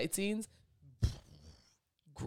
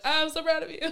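high, loud gush of pride; proud squeal; 14 semitones higher, 9 dB louder than usual. Retold or imitated. Praising the musician whose song made the movie, high proud squeal. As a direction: squeal it with joy.

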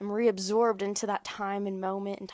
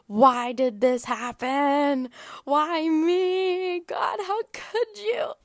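high, slow, whiny mock wail; hammed-up weeping plea to God; a put-on cry of self-pity, drawn out high; mock sobbing despair; 6 semitones higher, 4 dB louder, much slower than usual. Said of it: "I'm just sobbing and I'm just like a pit of despair".

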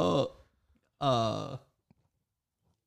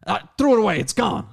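louder, high and defiant; blunt loud answer; 8 semitones higher, 8 dB louder than usual. Retold or imitated. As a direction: toss it off defiantly. Steve's retold blunt answer.

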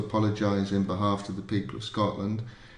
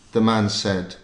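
ordinary talk, nothing marked

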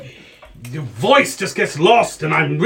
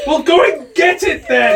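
shouting; 11 semitones higher, 4 dB louder than usual. Acted. Wizard shouting at gnome.

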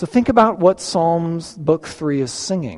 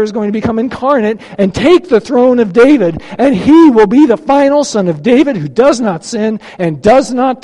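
louder, higher, emphatic sermon build; preaching at full force; 4 semitones higher, 5 dB louder than usual. Acted. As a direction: drive each line home like a preacher's climax. Preaching climax on David's line.

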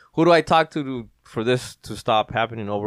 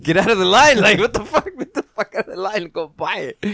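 laughing exasperation; 6 semitones higher, 4 dB louder than usual. Retold or imitated. Retold exasperation at lady.

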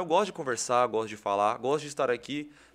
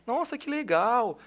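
higher and slow, mock delighted; delighted fan; 9 semitones higher, slower than usual. Fan delighted the host lives nearby, drawn-out high voice. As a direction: draw out the delight. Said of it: "Não, eu moro aqui".

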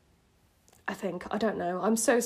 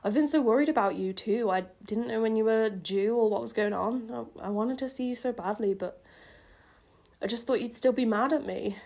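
plain reading voice

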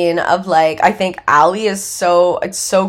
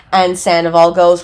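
ordinary talk, nothing marked

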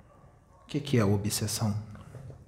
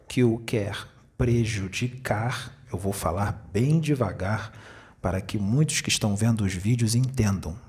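normal talking voice

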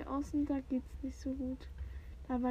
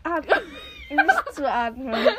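laughing; 4 semitones higher, 9 dB louder than usual. Real, not acted. Laughing while saying hold breath.

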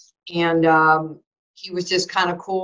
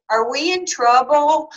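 put-on higher, louder, slow child's question; worried little boy; 8 semitones higher, 4 dB louder, slower than usual. Three-year-old son asking his mom, anxious and slow. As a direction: ask it like a nervous toddler.

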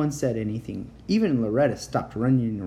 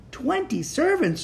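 yelled question. A narrator's reading. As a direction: shout it in disbelief. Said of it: "Josh yelled".

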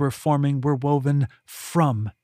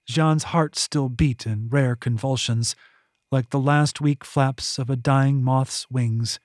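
usual speaking voice

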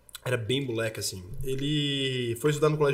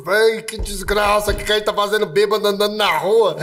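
put-on town drunk; 5 semitones higher, 8 dB louder than usual. Drunk walking in the street.

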